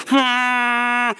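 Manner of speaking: a long flat hummed horn drone; steady buzzing vuvuzela hum. Retold or imitated.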